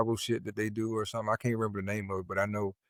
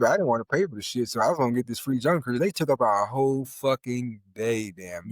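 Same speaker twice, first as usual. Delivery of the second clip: ordinary talk, nothing marked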